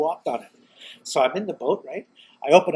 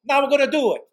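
higher, louder burst of resolve; imitated eureka; 9 semitones higher, 5 dB louder than usual. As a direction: announce the big discovery, acting it out. Acts partner's eureka after call.

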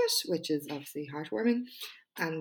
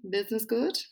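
higher, rising mock inquiry; imitated small-talk question; 6 semitones higher, 3 dB louder than usual. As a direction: ask it like a chatty acquaintance. Voices acquaintance's nosy small-talk question.

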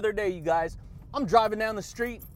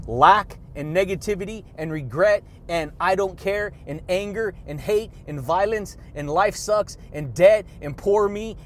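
ordinary talk

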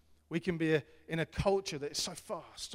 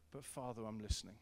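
very soft, surrendering whisper-like prayer; quiet devoted prayer; hushed prayer; 5 semitones lower, 8 dB quieter, faster than usual. Retold or imitated. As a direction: drop to a hush, yielding.

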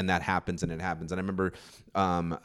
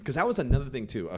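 ordinary talk

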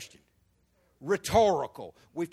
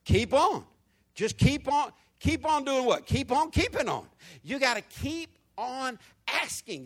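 rising, louder preacher cadence; preaching at full force; 6 semitones higher, 6 dB louder than usual. Acted. Pastor rallying congregation, loud fervent preaching. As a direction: build the crowd up on keep on. Preacher builds, repeating keep on.

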